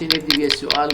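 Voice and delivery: normal talking voice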